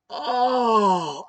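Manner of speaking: long, loud, high "oh" of horror; re-enacted drawn-out cry of dismay; horrified cry. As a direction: cry out as if watching it happen. Horror as IV is pulled out.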